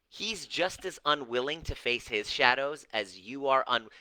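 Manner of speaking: usual speaking voice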